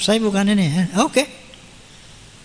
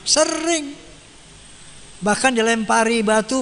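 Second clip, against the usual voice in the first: ordinary talk, nothing marked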